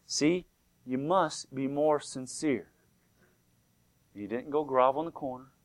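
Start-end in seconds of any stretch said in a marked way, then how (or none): 0.1-2.7 slow, hushed repetition for weight; voice from heaven. Retold or imitated. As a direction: draw it out softly and solemnly. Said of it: "He said".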